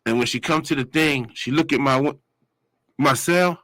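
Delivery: ordinary delivery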